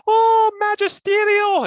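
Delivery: very high, slow, hammed-up exclamation; over-the-top Ray Hudson. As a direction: stretch the fancy word like a dictionary find. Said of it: "do my Scottish accent and become Ray Hudson".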